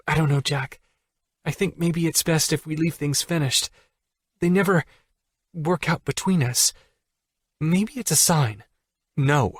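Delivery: Allie hesitant. Acted. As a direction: pull back, unsure.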